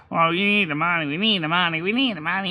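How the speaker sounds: pushy collector. Retold or imitated. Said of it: "this guy kept saying".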